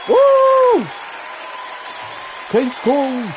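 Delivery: loud, high victory whoop; triumphant cheer. Real, not acted. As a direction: cheer the win at full voice. Celebrating the verdict.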